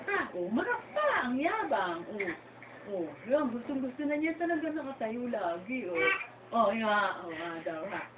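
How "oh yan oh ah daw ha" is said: normal talking voice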